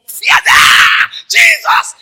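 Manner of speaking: hammed-up loud high scream under the whip; mock pain scream. Actor-Jesus being whipped.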